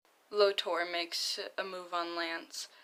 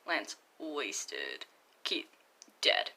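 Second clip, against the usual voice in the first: usual speaking voice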